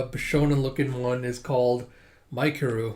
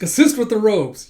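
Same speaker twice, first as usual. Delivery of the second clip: loud, raised order; drill command; 7 semitones higher, 7 dB louder than usual. Retold or imitated. Senior orders junior to greet, loud command. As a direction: call it out as an instruction.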